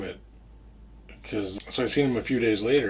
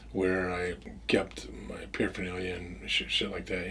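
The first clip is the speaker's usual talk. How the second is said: hushed whispered aside; whispering; 3 semitones lower, 7 dB quieter than usual. Real, not acted. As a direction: drop to a whisper, secretive. Hushed aside about drugs.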